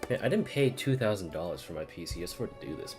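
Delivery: ordinary talk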